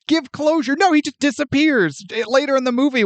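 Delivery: normal talking voice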